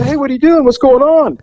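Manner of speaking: high, chirpy call out a car window; playful curious driver calling out; curious sightseer. Retold or imitated. Driver calling to a girl on the corner, chirpy and high.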